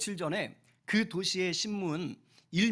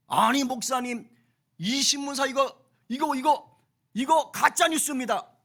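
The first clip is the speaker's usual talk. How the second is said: higher, louder, agitated protest; indignant staff; 8 semitones higher, 7 dB louder than usual. Retold or imitated. Staff protesting to Moody about the newspaper, agitated. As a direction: act out outraged helpers complaining. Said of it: "하면서 막 난리가 난 거예요".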